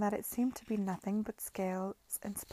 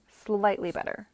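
repressive; 7 dB louder than usual. Acted. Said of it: "Lady Denham said repress-, repressively".

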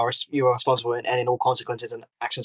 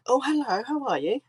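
much higher, sugary mock-polite greeting; sudden sweet politeness; 12 semitones higher than usual. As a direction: switch to fake sweetness the moment she meets the manager. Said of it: "demeanor changed instantly".

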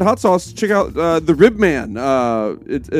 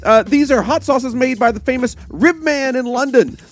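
slightly higher letter-reading voice; letter read aloud; 6 semitones higher than usual. Acted. Host reads a listener's letter to the others, reading voice.

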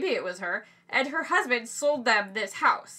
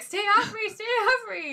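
panicked yelp; 11 semitones higher than usual. Retold or imitated. Panicked run from mosquitoes.

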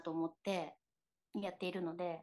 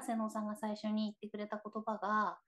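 ordinary delivery

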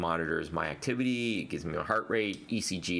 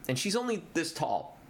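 ordinary talk, nothing marked

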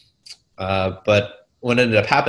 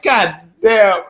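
mock dismay; 10 semitones higher, 5 dB louder than usual. Retold dismay.

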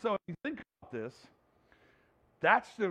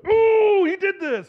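high, loud mocking jab at husband; wife waking up still mad, mock accusing husband in a loud, high squeal; wife's mock accusation; 13 semitones higher, 13 dB louder, slower than usual. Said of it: "woke up and was like".

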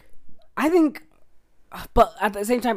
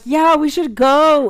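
higher, slow put-on girly voice; drawn-out mock of her reply; mock friend voice; 6 semitones higher, 5 dB louder, slower than usual.

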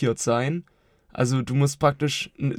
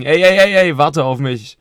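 calling out; 3 semitones higher, 8 dB louder than usual. Real, not acted. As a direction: hold the floor with a loud call. Interrupting to hold the floor.

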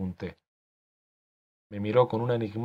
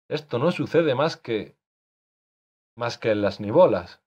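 sarcastic; 2 semitones higher, 4 dB louder than usual. Acted. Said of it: "concluyó él con sorna".

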